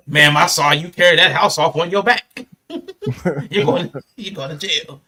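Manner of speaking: a loud, hammed-up cop busting someone; mock cop. Mocking a cop who arrests a caller for lying, loudly. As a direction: bark out the arrest like a joking cop.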